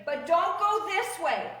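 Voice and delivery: ordinary talk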